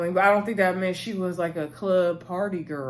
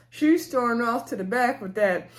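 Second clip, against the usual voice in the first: usual speaking voice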